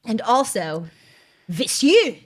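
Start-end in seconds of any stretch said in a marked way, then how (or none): 1.5-2.2 mock German accent, W turned to V; "Monsieur" in a comic German accent. Shown as a demonstration. Host showing how Germans say "with you", German accent. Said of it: "that's speaking in a German accent".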